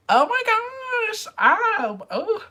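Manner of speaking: embarrassed excitement. Real, not acted. Recalls seeing the band live.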